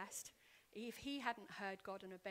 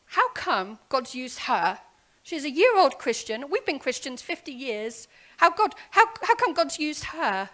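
loud, higher outraged pastors; indignant pastors; 5 semitones higher, 25 dB louder than usual. Retold or imitated. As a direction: protest like jealous senior pastors. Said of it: "The others were like".

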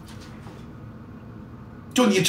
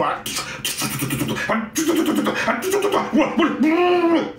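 sputtering engine sounds, rising in pitch; stalling engine noise; 9 semitones higher than usual. Acted. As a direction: imitate a car that won't start. Car engine failing to start.

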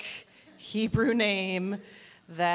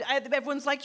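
ordinary talk

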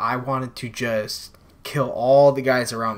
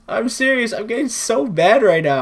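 high, loud frustrated complaint; 9 semitones higher, 5 dB louder than usual. Real, not acted. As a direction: vent at your own bad game. Raging about bad play.

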